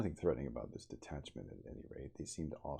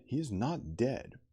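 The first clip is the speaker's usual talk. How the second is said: raised voice; 8 semitones higher, 6 dB louder, slower than usual. Acted. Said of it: "lifted up my voice".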